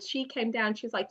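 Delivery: ordinary talk, nothing marked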